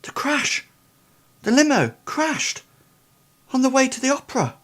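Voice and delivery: put-on very high-pitched, startled boy; high voice of a lad coming to; boy remembering. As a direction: blurt the memory in a high voice. Boy suddenly remembers, comes round.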